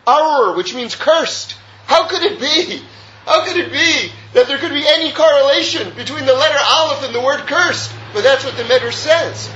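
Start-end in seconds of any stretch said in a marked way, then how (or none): 1.9-7.9 raised, emphatic incredulous questioning; rhetorical disbelief. Acted. Teacher questioning why Aleph would mean cursed, incredulous. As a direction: protest the puzzle as if baffled by it.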